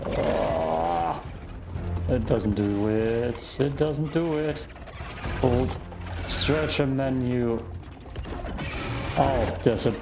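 usual speaking voice

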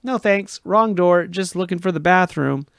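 panicked retort. Retold or imitated. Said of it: "I wanted to say".

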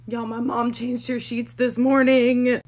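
loud, drawn-out disbelief; scandalised reveal. Real, not acted. Daughter tells viewers mom's secret, loud scandalised reveal. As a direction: spill it slowly and loudly, scandalised.

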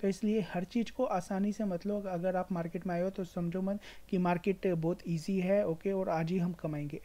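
ordinary talk, nothing marked

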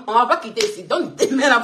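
normal talking voice

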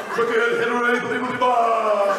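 high, loud, drawn-out Trump caricature; Alec Baldwin's Donald Trump. Acted. Comedian mimicking a sketch-show president, loud caricature. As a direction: drag the words out big and loud. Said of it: "This is Alec Baldwin's impression of Donald Trump".

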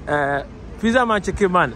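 Pizza Man and Chicken Man shouted high and loud; loud, high brand chant; hyped brand shout. Real, not acted. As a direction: call out the brand names like a hype man. Hypes the brand on arrival.

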